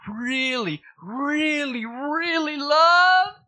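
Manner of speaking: building from normal to very high and drawn out; teasing, mounting excitement, voicing God; playful God voice. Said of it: "guess what God is saying to you".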